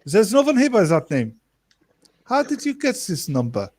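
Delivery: suspicious mock German accent. Shown as a demonstration. Prankster interrogates an unknown caller, mock German accent. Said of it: "mine isn't Russian".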